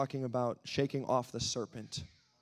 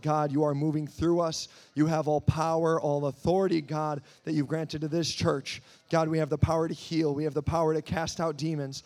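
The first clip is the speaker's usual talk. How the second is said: loud, fervent prayer; preaching prayer; 4 semitones higher, 8 dB louder than usual. Acted. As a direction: pray with full force to God. Opening prayer at full force.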